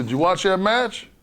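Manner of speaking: high, loud confrontational shout; angry yell. Retold or imitated. Yoko confronting Dean on the bus, yelling. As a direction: shout it in his face. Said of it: "He said".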